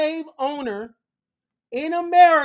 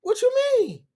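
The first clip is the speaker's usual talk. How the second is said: high confused John; 9 semitones higher, 4 dB louder than usual. Retold or imitated. John protesting to Anthony, high and bewildered. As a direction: play the freed man baffled, up high. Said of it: "John like".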